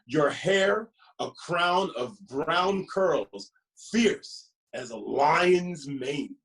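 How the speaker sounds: lively, sing-song picture book reading; reading to children. Acted. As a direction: read it like story time for little ones.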